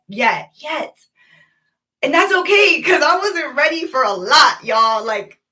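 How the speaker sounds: ordinary talk, nothing marked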